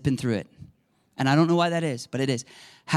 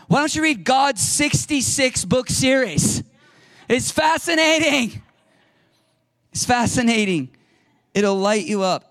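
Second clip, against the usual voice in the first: high, loud, impassioned sermon; fervent preaching; 9 semitones higher, 5 dB louder than usual. Acted. Preacher urging his flock to read the Bible, fervent and loud. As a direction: preach it out like a revelation.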